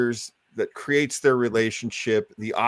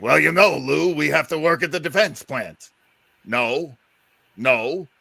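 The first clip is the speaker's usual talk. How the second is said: Abbott impression; 3 semitones higher than usual. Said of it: "Bud Abbott studying his lines".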